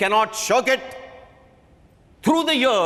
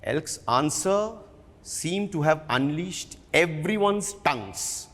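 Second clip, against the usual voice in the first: ordinary delivery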